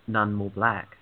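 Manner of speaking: ordinary delivery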